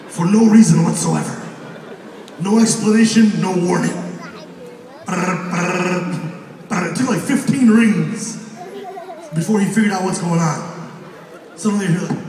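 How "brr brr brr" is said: low trilled ringing noise; imitated phone ring.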